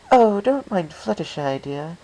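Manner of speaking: smooth over a shy friend, a touch genteel; lightly voiced Rarity.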